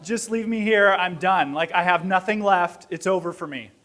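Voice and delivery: ordinary delivery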